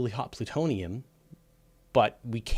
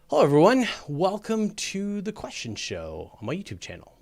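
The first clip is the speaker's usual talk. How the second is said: show host intro; 9 semitones higher, 4 dB louder than usual. Acted.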